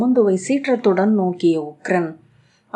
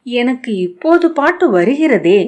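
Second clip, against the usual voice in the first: put-on higher, louder worried child; 6 semitones higher, 6 dB louder than usual. A narrator's reading. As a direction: fret anxiously about the song. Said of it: "கவலையுடன்".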